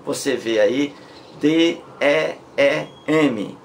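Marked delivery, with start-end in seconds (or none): 1.4-3.6 D, E, E, M named one letter at a time; teacher spelling the word out for the class. Shown as a demonstration. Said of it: "Esses dois Es aí".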